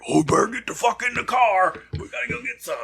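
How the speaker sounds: hammed-up wrestler command; Stone Cold. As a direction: hurry him to the car, gruffly. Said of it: "We're out of beer".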